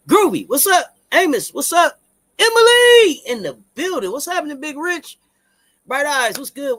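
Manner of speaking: loud, high shout-out to the viewers; calling out greetings. Real, not acted. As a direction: hype up each name as it comes in.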